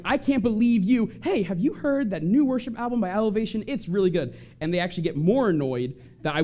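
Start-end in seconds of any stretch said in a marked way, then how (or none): 0.0-1.1 mock yell. Someone in my face yelling. 1.2-4.3 bright, higher, cheerily dodging the fight; breezy subject change. Retold or imitated. Peacemaker dodging a confrontation, breezy cheery subject change. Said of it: "I will change the subject".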